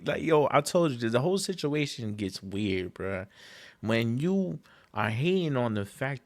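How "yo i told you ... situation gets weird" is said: normal talking voice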